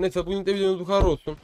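usual speaking voice

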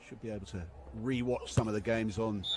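normal talking voice

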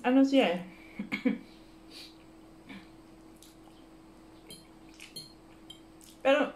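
pausing between bites; talking while eating. Real, not acted. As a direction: mouth partly full. Seen 1: holds fork over plate, mouth moving, eating during speech.

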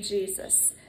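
ordinary delivery

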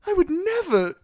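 very high, flustered denial; prim, high-pitched indignation; embarrassed Sam. Acted. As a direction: squeak out a scandalised protest. Sam reddens at the teasing.

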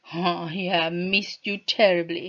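ordinary delivery, nothing marked

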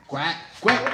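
loud high duck quacks; Donald Duck quack. Acted. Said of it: "quack like Donald Duck".

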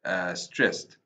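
ordinary talk

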